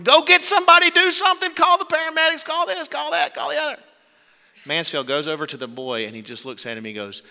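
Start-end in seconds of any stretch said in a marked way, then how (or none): 0.0-3.8 high-pitched, loud parental panic; frantic yelling. Acted. Freaked-out parents demand help for their stuck boy, frantic yelling. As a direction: yell orders as if it's an emergency. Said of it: "they started yelling at him".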